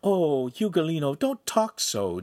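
put-on mildly reproving young woman; light scolding. A narrator's reading. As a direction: chide playfully, without anger. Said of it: "Giulietta scolded lightly".

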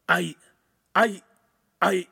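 halting, separated false starts; stunned stutter; stammering. A narrator's reading. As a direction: stammer with pauses, lost for words. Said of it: "he stammered".

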